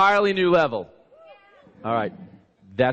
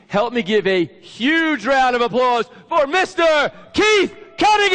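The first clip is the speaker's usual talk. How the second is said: big rising build into the name; announcer shout; 10 semitones higher, 6 dB louder than usual. Real, not acted. Host introduces the speaker to the crowd, shouting. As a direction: hype the crowd and yell the name out, higher and louder.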